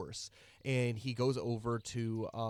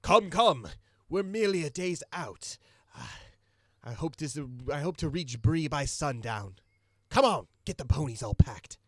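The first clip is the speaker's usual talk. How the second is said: loud, higher hurried calls; calling out; 5 semitones higher, 11 dB louder than usual. Acted. Merchant calling to dwarves to pack faster, loud. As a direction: hurry the workers along. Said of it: "he calls out to, uh, the dwarves".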